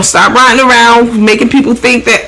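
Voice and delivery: ordinary delivery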